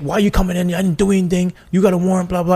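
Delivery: upset resident. Retold or imitated. Retold upset resident confronting cops.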